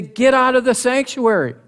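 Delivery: high, loud order to get out; bold command. Retold or imitated. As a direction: throw the king out with your voice. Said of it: "Then they say".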